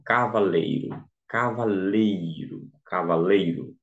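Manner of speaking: "cavaleiro" com LEI bem alongado; tônica na penúltima sílaba. Shown as a demonstration. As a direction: repita "cavaleiro" devagar, esticando o LEI. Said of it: "a sílaba tônica é lei". (ênfase didática no LEI de "cavaleiro")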